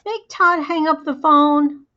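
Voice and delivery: high, loud, drawn-out complaint; yelling for mom. A narrator's reading. Girl calls mom about her brother, indignant yell. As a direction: holler for a parent's help. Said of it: "And then I yelled".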